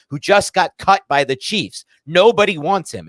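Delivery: ranting, fed up and loud; heated rant about a bad roster move; angry rant. Real, not acted. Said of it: "And I'm back to being angry".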